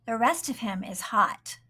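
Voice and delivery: usual speaking voice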